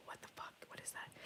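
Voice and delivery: hushed, scared retold question; frightened whisper. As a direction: whisper it as if something might hear. Retold fright after werewolf.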